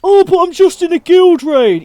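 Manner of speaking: high, loud mock protest; whining brother. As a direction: protest like a put-out sibling mid-game. Acts brother protesting the request.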